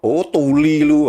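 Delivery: high, drawn-out jeer; insulting intonation. Shown as a demonstration. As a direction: throw it out as a taunting insult. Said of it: "intonasinya berbeda".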